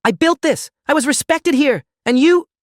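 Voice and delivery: very high, loud, outraged shouting; furious screaming. A narrator's reading. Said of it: "Derek screamed it".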